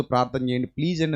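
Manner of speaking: tearful plea. Retold or imitated. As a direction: gently echo her tearful begging. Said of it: "భయంకరంగా ఏడిచింది". (ఏడుస్తూ ప్రార్థన కోసం వేడుకోలు)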